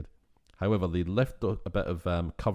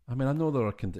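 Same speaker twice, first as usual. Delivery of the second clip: ordinary delivery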